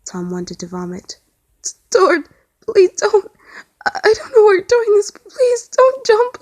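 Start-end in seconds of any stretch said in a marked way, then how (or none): 1.9-6.4 a crying plea to a friend at the railing, choked. A narrator's reading. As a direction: beg through sobs. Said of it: "Tom choked out".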